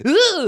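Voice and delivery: high, loud cry of terror; collapsing yelp. Acted. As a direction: yelp as your legs give way. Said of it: "Orvex just collapses".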